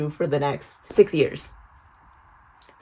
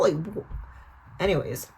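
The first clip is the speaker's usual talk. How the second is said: normal talking voice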